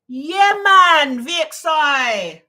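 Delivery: loud, high, drawn-out exclamation; excited, stretched-out cheer of recognition; delighted recognition. Real, not acted. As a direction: burst out as the name clicks. Suddenly places the name.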